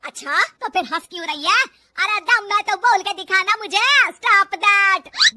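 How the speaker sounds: very loud, drawn-out dare; angry mother-in-law's taunt; furious challenge. Acted. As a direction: goad her at full volume, ending slow and high. Saas goads bahu angrily.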